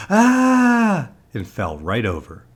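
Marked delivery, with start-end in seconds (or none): 0.1-1.1 loud, very high scream; startled yell. A narrator's reading. Lady at the door sees Lance's face, startled yell. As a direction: scream in shock at a scary face. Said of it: "The lady yelled".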